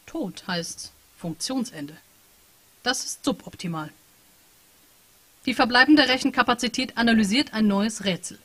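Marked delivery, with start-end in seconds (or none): 0.1-3.9 slow cold statement. Acted. Machine voice stating death flatly.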